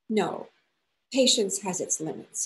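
plain reading voice

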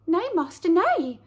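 high, pleading little lamb; a timid high lamb. Acted.

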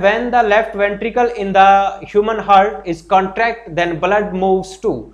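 very slow, word by word English read-out; dictating the exam question slowly. Shown as a demonstration. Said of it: "ये कह रहा है".

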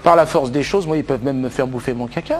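usual speaking voice

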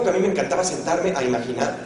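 usual speaking voice

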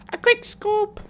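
"quick scope" squealed very high; squeaky mock echo. Squeaky echo of own phrase.

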